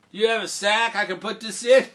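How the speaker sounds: high, loud naive question; eager simple Jack. Acted. Jack asking the farmer for a sack, high loud voice.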